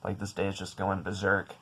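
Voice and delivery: hushed, exasperated grumble; soft, worn-down irritation; irritated, under the breath. Retold or imitated. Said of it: "getting more and more irritated".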